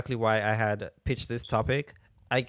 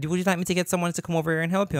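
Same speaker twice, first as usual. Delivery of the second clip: quick, higher voiced offer of help; store manager; 7 semitones higher, much faster than usual. Manager offering help to shopper, quick and higher. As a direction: imitate a brisk, helpful manager.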